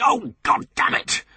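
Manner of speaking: high, loud curse at the pips; shout. Acted. Anthony cursing the pips on the phone, a loud shout. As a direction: yell it out in frustration. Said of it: "He shouted".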